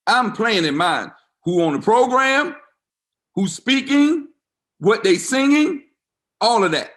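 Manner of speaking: rising to a preacher's peak; fired-up preaching. Real, not acted. Pastor preaching to the congregation about his funeral, fired up.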